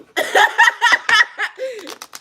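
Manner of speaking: long high cackle falling away; real delighted cackle; long high laugh. Real, not acted. Laughing at own quote.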